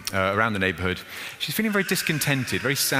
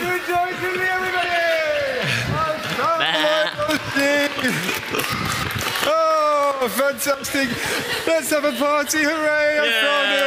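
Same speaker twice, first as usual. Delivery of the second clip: high, loud rejoicing shout; rejoicing shepherd; 14 semitones higher, 3 dB louder than usual. Acted. Shepherd shouting to neighbors to rejoice, party-loud. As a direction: whoop with celebration. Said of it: "And he said".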